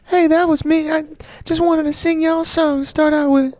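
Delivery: [name] voice. Pretend. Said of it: "[name]".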